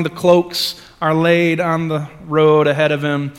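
ordinary delivery

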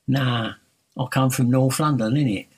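put-on London street accent; mock-cheeky North London reply. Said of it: "That's not a local accent".

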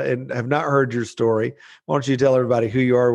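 normal talking voice

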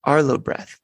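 lightly voiced toddler trying the words; a hint of a small child copying dad; little boy echoing his father, lightly done; imitating toddler. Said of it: "he looked at me and he said".

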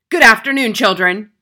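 loud headmistress bark; stern shouted greeting with a high start. A narrator's reading. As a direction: bark it at the class, hard and loud. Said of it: "she barked".

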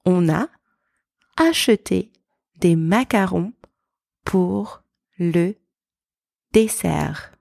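French in small chunks with long pauses; careful, segmented French model; slow practice sentence. Shown as a demonstration. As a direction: leave gaps for learners to repeat. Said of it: "Let's practice together".

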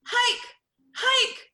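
very high, loud throaty "hike"; from the throat. Shown as a demonstration. Coach showing the wrong way, forced from the throat. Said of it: "if I do it from the throat".

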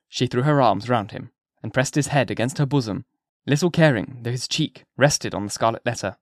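ordinary delivery, nothing marked

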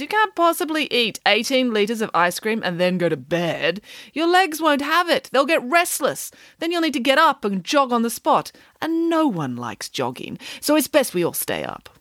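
Nanny Piggins lecturing. A narrator's reading. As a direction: lecture the children like a self-assured nanny. Nanny Piggins argues to stay up.

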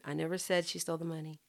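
soft, quick, even chant with no word stressed; neutral unison. Shown as a demonstration. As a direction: recite it quietly and evenly, like a choir in unison. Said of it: "Just stay neutral, just stay".

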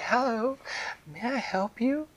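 high, gentle fairy voice; sweet fairy offering help; dream fairy. Acted. Fairy greeting the hurt fish, high and gentle. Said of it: "the dream fairy walked over to Ramuna and said".

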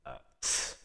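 short imitated hiss of disapproval; imitated tss. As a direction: make a sharp, voiceless "tss". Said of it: "all I hear is, like, a".

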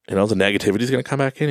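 ordinary delivery

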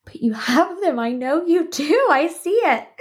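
high, insistent little protest; mock pleading. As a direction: plead as if catching them out. Retold pleading with withholding coordinator.

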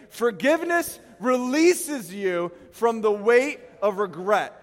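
preacher leading the church in saying it together; said together with the crowd. Shown as a demonstration. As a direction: chant it with the crowd slowly. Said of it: "Say it with me".

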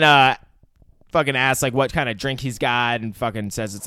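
ordinary delivery

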